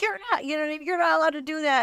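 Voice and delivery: high, mock scolding guard voice; guard scolding an inmate for writing on the wall, high and mocking. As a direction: pitch it high like a prissy guard telling you off. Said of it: "They were like".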